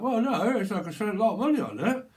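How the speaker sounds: acted affronted customer; defensive protest. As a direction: justify the expense emphatically. Acted Botox patient protesting.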